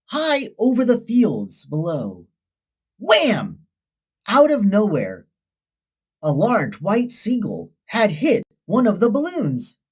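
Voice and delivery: ordinary delivery, nothing marked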